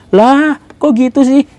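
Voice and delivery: high-pitched acted disbelief; baffled protest. Acted Indonesian objecting to claim.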